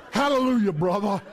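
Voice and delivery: hammed-up churchgoer's hearty greeting; pretend piety. Quarrelling parent greeting church members, put-on piety. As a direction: greet with fake holy cheer. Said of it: "show 'em some pretending".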